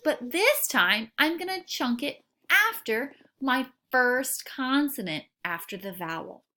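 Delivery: ordinary delivery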